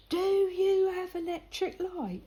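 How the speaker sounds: high, slow, drawn-out imitation; mock-incredulous quizzing. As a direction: spell it out as if to someone backward. Lad mockingly quizzes her on home.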